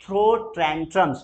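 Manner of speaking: very slow, throw stressed; slow drill; very slow model. Shown as a demonstration. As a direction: stretch both words. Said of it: "throw भी बोल सकते".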